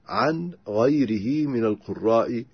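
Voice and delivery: normal talking voice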